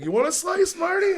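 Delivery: very high singsong offer, rising; Stuart. Retold or imitated. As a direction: pipe up with a comic, squeaky question. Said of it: "And he's like".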